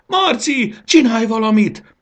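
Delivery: very high, loud shriek for help; screamed plea. A narrator's reading. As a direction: scream it at him, urgently. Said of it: "sikított Fanny". (sikítva, segítségért kiáltva)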